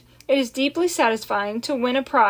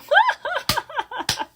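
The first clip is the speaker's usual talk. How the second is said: high roaring laugh; crowd laughter. A narrator's reading. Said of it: "The crowd roared with laughter".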